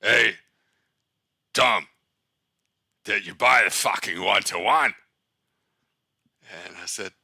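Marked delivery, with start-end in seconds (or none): 0.0-4.9 put-on loud, slurry drunk Australian bellow; loud drunk Australian voice, hammed up; drunk Australian accent. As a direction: play a drunk Aussie mentor yelling at his trainee. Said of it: "in an Australian accent with somebody who's drunk".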